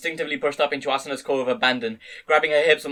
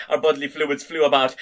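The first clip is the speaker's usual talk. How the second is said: ordinary delivery, nothing marked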